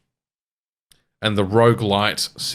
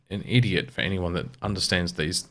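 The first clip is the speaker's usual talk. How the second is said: normal talking voice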